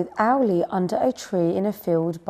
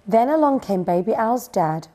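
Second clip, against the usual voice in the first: ordinary delivery, nothing marked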